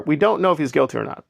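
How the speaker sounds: ordinary talk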